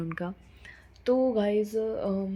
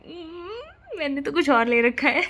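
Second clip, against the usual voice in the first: high, drawn-out hum then a louder playful retort; coy teasing reply; 8 semitones higher, 5 dB louder, slower than usual. Retold or imitated. Sister teasing her brother about the surprise, high and playful. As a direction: tease him that the gift is already bought. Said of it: "And I was like".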